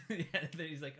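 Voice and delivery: usual speaking voice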